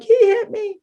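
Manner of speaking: put-on high, loud wail of a hurt little girl; crying child. Little girl tells the neighbour mom she was hit, mock wailing. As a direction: cry it out like a hurt kid. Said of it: "and I'm crying".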